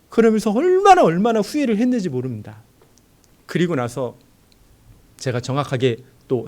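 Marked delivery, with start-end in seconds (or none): 0.1-2.6 high, loud stress on "얼마나"; loud, high preaching on regret; emphatic regret. Acted. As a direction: lean hard into "얼마나", then ease down.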